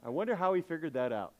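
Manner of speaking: normal talking voice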